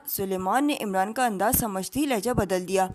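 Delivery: ordinary delivery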